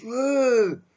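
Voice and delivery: high, loud whoop of delight; cheering woo. Retold or imitated. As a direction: cheer like an excited crowd. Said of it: "everybody goes".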